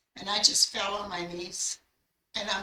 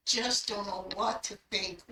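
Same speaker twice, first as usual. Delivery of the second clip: normal talking voice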